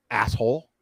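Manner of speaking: ordinary delivery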